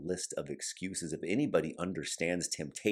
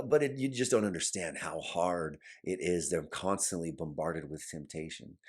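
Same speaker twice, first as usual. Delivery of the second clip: ordinary talk, nothing marked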